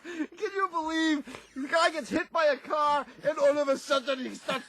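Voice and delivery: high, laughing incredulous words; can't believe it, still giggling; laughing disbelief. Real, not acted. Host laughing at the accident story.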